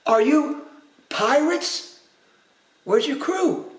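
high, astonished questions; storybook gasp of surprise. A narrator's reading. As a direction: ask in halting, high-pitched amazement. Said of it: "Goldenlocks gasped".

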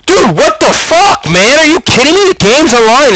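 very high-pitched disbelieving rant; exasperated outburst. Retold or imitated. Player berating his teammate over a losing play, exasperated and high.

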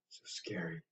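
whispered, low and spooky; whispered mock fear. Parent pretending to be scared of the next dinosaur, in a whisper. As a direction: breathe it like it's too scary to say aloud. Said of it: "mock fear".

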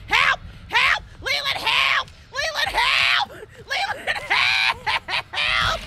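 high, loud panicked cries; screaming for help. Real, not acted. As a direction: shriek to a teammate for rescue. Screaming to teammate for rescue.

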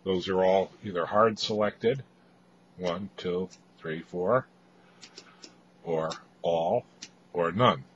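ordinary delivery

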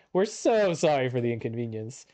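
ordinary delivery

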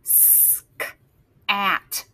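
sounding out. Shown as a demonstration. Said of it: "This says sk".

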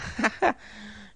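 bitteres, hohes Auflachen; verbissenes Auflachen. A narrator's reading. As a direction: kurz und verbissen auflachen. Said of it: "Er lachte verbissen auf". (verbissen lachend)

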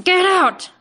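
loud, high, shouted order; furious demand. A narrator's reading. Butcher ordering the hunter out, shouting. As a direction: throw him out with it. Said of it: "demanded Sloane".

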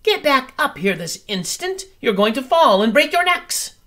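very high, loud bird-mother scolding. Acted. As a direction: snap at the children to get back. Mother bird scolds her babies.

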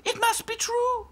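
high haughty doll voice; snooty little princess. A narrator's reading.

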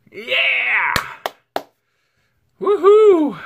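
high, loud woohoo; cheering. Real, not acted. Vlogger cheering high and loud over a hundred subscribers. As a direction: cheer the milestone.

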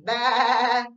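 a silly wrong answer through a laugh; laughing bah. Pretend. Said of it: "what sound a frog makes".

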